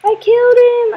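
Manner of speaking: high, loud cry of victory; thrilled gamer shouting a win; triumphant shout. Real, not acted. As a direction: yell it out twice, elated. Has just beaten the boss.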